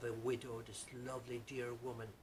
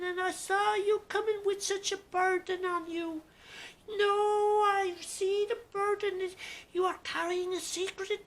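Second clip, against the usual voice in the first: put-on old wise woman; 12 dB louder than usual. Wise old healer greeting the young barber, high crone voice. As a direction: cackle it out like an ancient seer.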